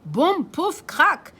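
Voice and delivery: loud punchy sound words; crash effect. Acted. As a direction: bang out the crash. (boum pouf crac lancés fort)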